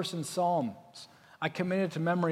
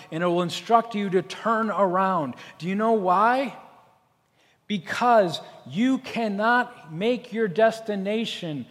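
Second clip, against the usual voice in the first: usual speaking voice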